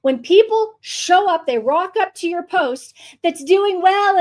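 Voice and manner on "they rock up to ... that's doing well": ordinary talk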